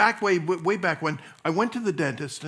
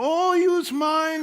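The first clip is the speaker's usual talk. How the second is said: put-on high, slow Cuban accent; drawn-out high Cuban-accented offer; 13 semitones higher, 5 dB louder, slower than usual. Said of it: "a man with a Cuban accent comes over".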